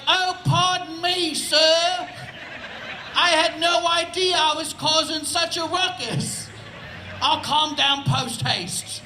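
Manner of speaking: hammed-up British gentleman dog. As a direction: apologise like a very proper British dog. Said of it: "it's a British dog".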